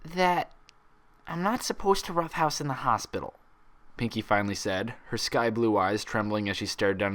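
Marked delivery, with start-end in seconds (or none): 0.0-3.3 much higher, meek admission; sheepish child. A narrator's reading. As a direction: mumble it like a scolded kid. Pinkie sheepishly admits.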